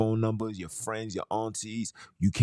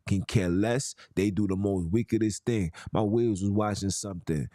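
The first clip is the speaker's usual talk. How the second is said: ordinary delivery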